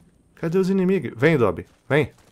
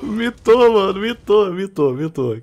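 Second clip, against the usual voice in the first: high, loud cheer; gleeful loud shout; excited cheer; 6 semitones higher, 5 dB louder than usual. Real, not acted. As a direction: celebrate the big play. Celebrating a great play.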